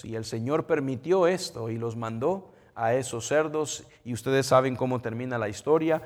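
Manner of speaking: ordinary talk, nothing marked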